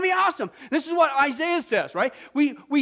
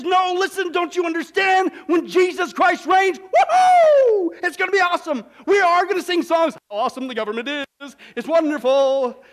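louder, higher, jubilant with a whooping cry; excited Isaiah; 3 semitones higher, 4 dB louder than usual. Acted. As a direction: celebrate the coming reign. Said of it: "And Isaiah says".

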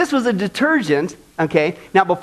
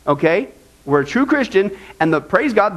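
usual speaking voice

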